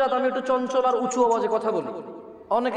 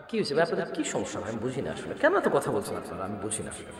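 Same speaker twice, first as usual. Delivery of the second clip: soft, weary scolding of the noisy crowd, much quieter; quiet exasperated rebuke; 12 semitones lower, 6 dB quieter than usual. Real, not acted. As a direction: drop the voice and chide the audience in disbelief. Audience talking during the sermon. (নিচু গলায় বিরক্ত তিরস্কার)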